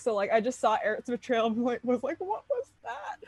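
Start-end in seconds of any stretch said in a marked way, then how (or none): 2.2-3.2 high, soft, drawn-out disbelief; small high-pitched mock shock; retold bafflement. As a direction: squeak it quietly, as if stunned. Retelling her stunned reaction to betrayal.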